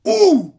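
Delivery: a loud high ouh of a pounding heart; excited high-pitched ouh; heart whoosh. Retold or imitated. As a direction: shoot it up like a heartbeat jolt. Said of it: "J'avais mon cœur qui faisait".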